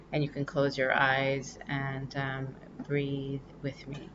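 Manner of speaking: soft, slowing guided-breathing voice; soft spa whisper. Acted. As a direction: ease listeners into breathing gently.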